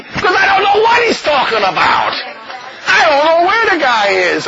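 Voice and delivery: hammed-up baffled yell; exasperated outburst. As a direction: shout it in comic exasperation, imitating a baffled brother. Exasperated quote of the brothers.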